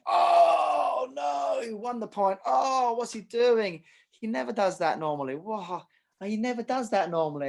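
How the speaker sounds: dad watching the match, anguished shouts; emotional dad. Retold or imitated. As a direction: groan at every lost point like a fretting parent. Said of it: "it's all very emotional".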